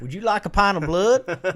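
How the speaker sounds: put-on meant British, slipped into a southern accent; accidental southern accent. Joking about satanic metal bands, hammed-up southern accent. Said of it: "Now I'm doing a southern accent".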